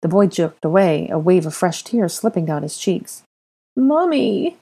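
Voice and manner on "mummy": put-on high, drawn-out toddler whine; pathetic whine. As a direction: whine pitifully for mummy. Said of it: "he whined pathetically".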